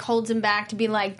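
ordinary talk, nothing marked

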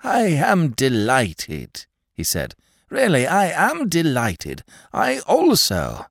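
slow, high, halting with eagerness; eager stammer. A narrator's reading. Mister Waller to Smith, stammering with eagerness. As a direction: trip over the words in delight. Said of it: "He stammered in his eagerness".

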